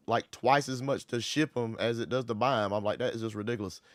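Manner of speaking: ordinary talk, nothing marked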